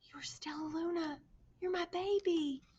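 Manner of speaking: soft tender whisper. A narrator's reading. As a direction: breathe it to your lost baby. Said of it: "She whispered".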